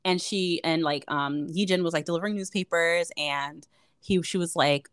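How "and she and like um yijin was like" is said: normal talking voice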